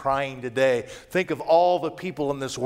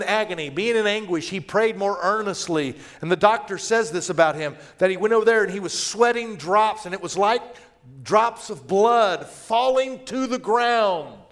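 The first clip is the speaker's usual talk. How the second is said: raised, higher, urgent preacher voice; fervent preaching; 6 semitones higher, 4 dB louder than usual. Acted. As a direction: drive the warning home. Preaching at full force.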